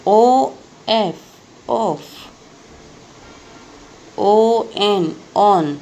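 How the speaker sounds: letter by letter, then the whole word; spelling aloud. Shown as a demonstration. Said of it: "देखो मैं कैसे एक एक, बोल के लिख रही हूँ". (हर अक्षर बोलकर स्पेलिंग बताना)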